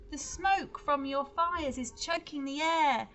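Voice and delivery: loud high protest chants; slow, loud pleading cries; crowd shouting. Acted. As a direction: shout demands at the giants. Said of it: "everyone in the crowd began to shout".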